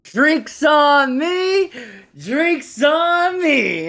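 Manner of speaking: very high, loud, long-drawn-out cheer; happy barroom holler. Acted. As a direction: shout it happily to the whole bar, stretching every word. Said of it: "all happy and all that stuff".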